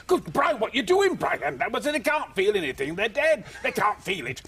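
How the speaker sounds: high-pitched, hammed-up protest; mock outrage, pitched well up; acted exasperated exchange. Squashing bodies into coffins, acting out a high, exasperated exchange.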